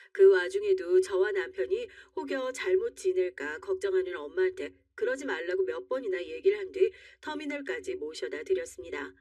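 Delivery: plain reading voice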